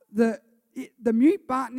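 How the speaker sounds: sudden loud preaching burst; loud emphasis. Real, not acted. Pastor loudly hammering home the mute button to the crowd. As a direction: punch it out loud to the room.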